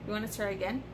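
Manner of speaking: ordinary talk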